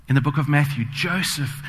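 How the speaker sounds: ordinary talk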